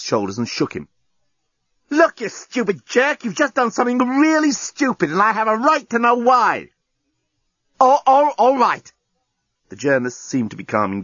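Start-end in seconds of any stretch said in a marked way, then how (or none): 1.9-6.7 high-pitched, heated tirade; furious scolding. A narrator's reading. Lucy shakes the journalist and demands why, angry. 7.8-8.9 high, loud, stammered surrender; flustered giving-in. A narrator's reading. Journalist gives in as Lucy shakes him, flustered.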